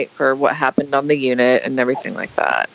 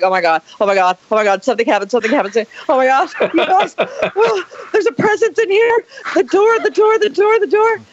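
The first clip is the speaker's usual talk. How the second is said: from fretting to a shrill high panic, much higher; mock panic building; 11 semitones higher, faster than usual. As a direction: ham up the fright, climbing into a squeal. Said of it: "I freaked all those girls out".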